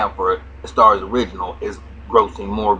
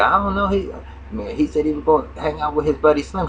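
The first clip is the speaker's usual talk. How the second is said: higher put-on girl's voice; daughter's voice; 7 semitones higher than usual. Retelling the show, he voices the daughter answering her mother in a high pitch. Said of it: "She said".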